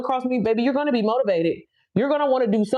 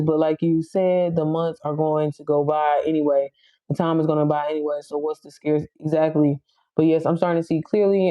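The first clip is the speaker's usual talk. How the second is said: lower, even, measured read-out; reading comment aloud; 5 semitones lower than usual. Real, not acted. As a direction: read a viewer's message word by word off the screen. Reads a viewer's comment aloud.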